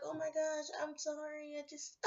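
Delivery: high, soft whimpering apology; mock crying. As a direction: imitate someone starting to cry mid-apology. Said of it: "they start crying and stuff like that".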